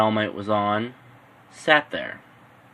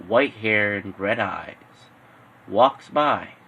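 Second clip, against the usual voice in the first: ordinary delivery, nothing marked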